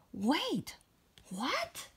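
breathy whispered surprise; surprised whisper. Real, not acted. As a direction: hush it in disbelief. Narrator reacts to talking volcano.

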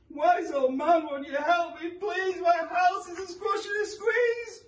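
put-on high, begging old woman; pleading old lady. A narrator's reading. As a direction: beg the wise man, high and loud.

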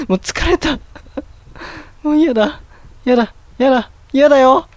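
worn-out pleading with a laugh in it; distressed whining. Real, not acted. Worn out, refusing scary game.

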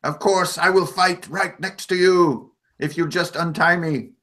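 high, urgent little goblin voice, slowing at the end; a small creature pleading for his people; goblin Vus. Acted. Vus bargains.

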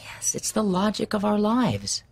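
whispered reassurance. Acted. Husband soothing his anxious wife in bed, whispered. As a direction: breathe it to her like a promise.

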